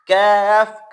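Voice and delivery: ordinary talk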